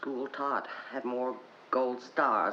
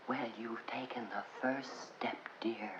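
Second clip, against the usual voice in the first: whispered, softer patronizing lady; mock whisper; 5 semitones lower, 11 dB quieter than usual. Imitating the nice lady at the meeting in a whisper. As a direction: breathe it out kindly like a church lady. Retold lady's kind remark.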